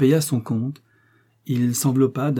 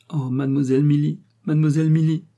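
high, loud emotional cry of her name; Sarah clutching Milly, crying out her name; 5 semitones higher, 4 dB louder than usual. Acted. Servant embracing her young mistress, calling out her name. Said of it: "s'écria-t-elle".

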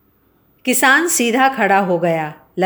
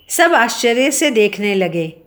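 usual speaking voice

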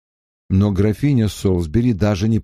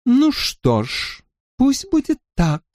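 teasing lady; 13 semitones higher than usual. Acted. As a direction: tease him sweetly. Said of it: "сказала сидящая с ним рядом графиня". (игриво-насмешливо)

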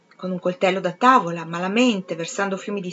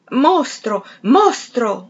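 loud, higher screamed accusation; screaming; 4 semitones higher, 6 dB louder, much slower than usual. Acted. Septimus screaming at the doctor entering, loud. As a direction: scream it at him. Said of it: "urlò Septimus".

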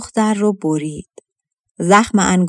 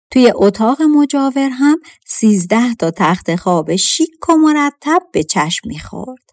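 ordinary delivery, nothing marked

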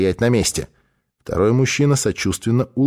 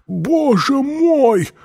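high, drawn-out cry of pain; strained, high-pitched dying gasp of words; agonized cry; 3 dB louder, much slower than usual. Acted. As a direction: cry out slowly as if stabbed. Sudden stabbing pain in the chest.

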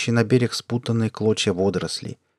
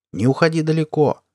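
raised, higher fatherly call; father's warning called across a distance; 7 semitones higher, 4 dB louder than usual. A narrator's reading. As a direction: call after a child from the porch. Father calls to daughter outside.

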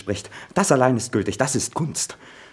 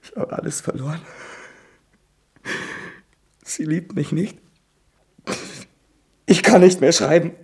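higher, broken tearful despair; weeping; 7 semitones higher than usual. Acted. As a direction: sob the words out hopelessly. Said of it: "Hör jetzt auf zu weinen".

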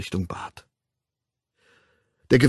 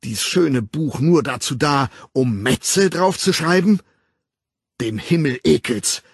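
raised, anguished reproach; outraged declamation; 5 semitones higher, 4 dB louder than usual. A narrator's reading. Betrayed man cursing the woman in verse, outraged declamation. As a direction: build the verse into a curse. His bitter verse builds to curse.

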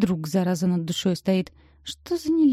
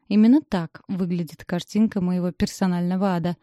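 usual speaking voice

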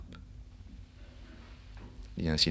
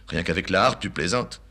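ordinary talk, nothing marked

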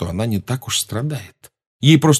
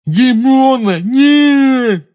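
gagged; 8 dB louder, much slower than usual. A narrator's reading. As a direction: shout muffled through a gag. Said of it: "промычал Рон сквозь кляп". (кричит сквозь кляп)

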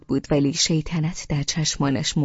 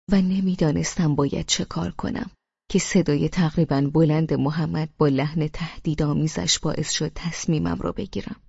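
plain reading voice

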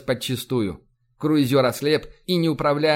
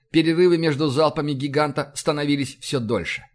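ordinary delivery

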